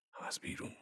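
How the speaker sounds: «بیرون» breathed out very softly, almost voiceless; barely voiced. Shams murmurs to the wounded man, «بیرون» barely voiced. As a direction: let «بیرون» fade to a breath. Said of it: "زیر لب گفت".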